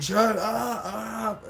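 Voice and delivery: high, drawn-out imitation of a drunken protest; imitated angry outburst. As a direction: wail it high, mimicking her irritation. Drunk woman snaps back while puking.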